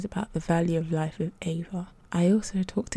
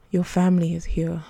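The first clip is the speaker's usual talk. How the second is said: ordinary delivery, nothing marked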